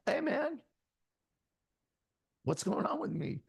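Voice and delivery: high-pitched, half-hearted alarm; mild retold panic. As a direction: act out a touch of rising panic. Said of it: "And I'm starting to panic".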